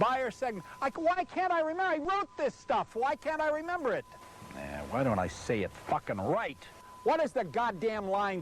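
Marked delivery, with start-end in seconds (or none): 0.8-4.0 exasperated, fast and much higher; frustrated outburst. Real, not acted. Pitchman fumbling his lines, frustrated high outburst. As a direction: berate yourself for forgetting.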